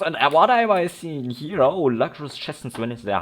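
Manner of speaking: sudden loud, high burst of delight; a gamer who just spotted loot; excited. Real, not acted. Spotting unexpected chests.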